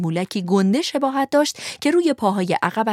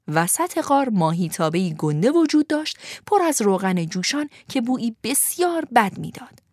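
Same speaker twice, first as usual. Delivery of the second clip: ordinary delivery, nothing marked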